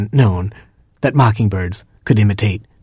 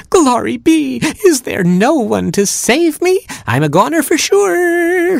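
very high, drawn-out chickadee screech; hysterical screech. Acted. Distant chickadee crying for rescue, high and hysterical screech. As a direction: screech it like a panicking little bird. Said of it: "screeched the distant chickadee, a bit hysterically".

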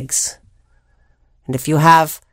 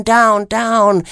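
usual speaking voice

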